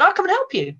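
high, keen retold offer; volunteer instantly, voice leaping up; lightly imitated chirpy helpfulness; eager offer. Acting out her eager offer.

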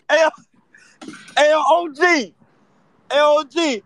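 loud, high call across the room. Real, not acted. Calling his friend O G over to share the Knicks news, loud excited shout.

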